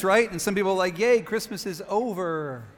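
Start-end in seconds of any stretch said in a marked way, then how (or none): 1.0-2.6 slow, drawn-out mock yay; half-hearted cheer. Imitating people glad Christmas is over, half-hearted cheer. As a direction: cheer without the enthusiasm, dragging it out.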